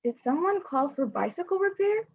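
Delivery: gentle read-aloud storytelling voice; story narration. A narrator's reading. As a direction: read it like a picture book to kids. Reads picture book to audience.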